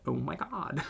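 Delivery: soft, tentative Valley Girl attempt; Valley Girl accent. Shown as a demonstration. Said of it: "Can I do a Valley Girl accent?".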